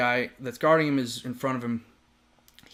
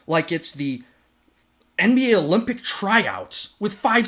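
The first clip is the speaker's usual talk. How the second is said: louder, higher heated rant; angry rant; 6 semitones higher, 6 dB louder than usual. Retold or imitated. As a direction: tell him off in disbelief. Retold rant at rough teammate.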